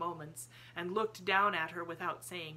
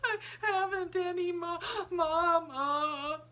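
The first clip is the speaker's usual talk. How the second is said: much higher, louder crying wail; sulky child half-heartedly howls; 13 semitones higher, 7 dB louder, slower than usual. A narrator's reading. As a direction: wail without much conviction. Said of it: "howl rather half-hearted".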